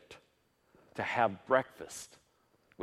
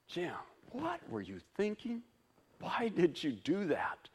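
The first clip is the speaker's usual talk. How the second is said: high, quieter, exasperated; mock self-scolding; 7 semitones higher, 3 dB quieter than usual. Preacher imitating his own inner critic, high and exasperated. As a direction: berate yourself in disbelief.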